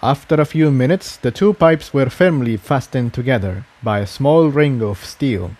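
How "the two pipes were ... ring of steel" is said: ordinary delivery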